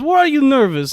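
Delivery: high mock Russian woman; thick Russian accent. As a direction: imitate her question in a Russian accent. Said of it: "she has, like, a thick Russian accent".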